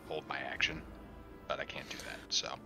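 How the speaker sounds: normal talking voice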